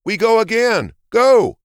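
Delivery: high, loud desperate shout; frantic yell. A narrator's reading. Exasperated hare shouts to race again.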